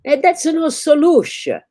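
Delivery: loud, high Italian repeat of "that's no solution". Acted. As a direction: shout it again in an Italian accent. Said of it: "like a loud Italian".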